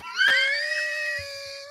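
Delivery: a sudden high shriek of laughter, cut loose. Real, not acted. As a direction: burst into a screeching laugh at the memory. Laughing at cousin's CD joke.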